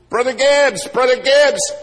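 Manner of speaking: loud high call across a parking lot. Retold or imitated. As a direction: shout his name from far off. Someone calls his name across lot.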